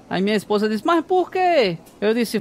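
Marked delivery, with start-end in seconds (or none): 0.8-1.8 surprised wife. Retold or imitated. Wife asks why book thrown.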